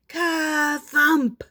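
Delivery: high, drawn-out "ka-thump"; heavy thump. A narrator's reading. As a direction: boom out the bag landing with relish. Storyteller voices heavy bag landing.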